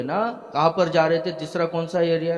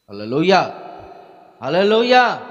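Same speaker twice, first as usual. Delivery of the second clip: rousing call; 3 semitones higher, 6 dB louder than usual. Real, not acted. Preacher repeats the praise for the congregation, louder rousing call.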